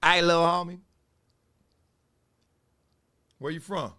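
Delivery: a hint of LA street menace; L A gang voice. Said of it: "That L A voice".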